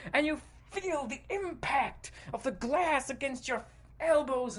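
frantic action narration. A narrator's reading. Player leaps through glass into prickly bush, intense narration. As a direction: push the urgency through each impact.

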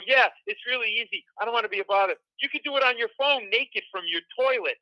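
high, over-eager nervous rambling; imitating his own flustered blurting; nervous babbling. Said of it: "What am I saying?".

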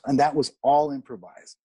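ordinary delivery

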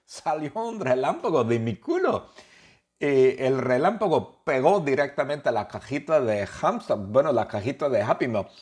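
high, loud, giddy narration; squeaky giddy retelling; excited storytelling. Acted. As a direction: build up to the silly punchline. Excited high telling of fart.

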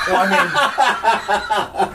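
talking through laughter; laughing. Real, not acted. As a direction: laugh out the words. Laughing while mocking.

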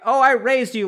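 put-on guilt-trip the child loudly; mock-outraged parent; indignant parent. Parent attacking again.

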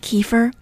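"kefir" as KEE-fer, rising at the end; long ee stressed in "kefir", KEE-fer, left rising; common US pronunciation. Shown as a demonstration. Teacher listing variants, first "kefir" as KEE-fer. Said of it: "however you pronounce it".

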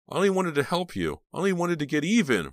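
high loud yell. A narrator's reading. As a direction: yell it in anguish. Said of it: "Rob yelled".

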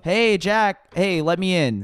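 eager greeting at the door. Retold or imitated. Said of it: "I'm like".

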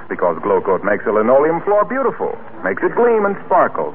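ordinary talk, nothing marked

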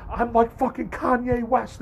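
high-pitched, worked-up outburst, retold; agitated mock boast. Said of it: "agitated and pissed off".

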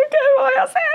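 high, loud sobbing wail; crying. Retold or imitated. Mother in the car after the diagnosis, crying out loud. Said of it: "me puse a llorar en el carro".

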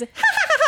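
high mock cackling laugh; imitated cackle. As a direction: cackle like her sister watching old videos.

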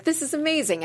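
bright, high burst of delight; excited quote. Retold or imitated. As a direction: sound thrilled at landing the part. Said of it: "I was like".

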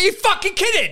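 high-pitched incredulous rant; outraged disbelief. Retold or imitated. Parent reacting to son's price for wheels, high incredulous outrage. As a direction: squawk it in comic outrage.

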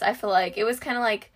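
usual speaking voice

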